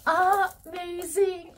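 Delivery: savouring amazing; drawn-out gush. Real, not acted. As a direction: stretch the word out in awe. Admiring the bag's compartments.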